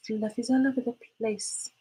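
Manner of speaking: a hushed crooning echo of Fluffies all over the place; soft sing-song. Pretend.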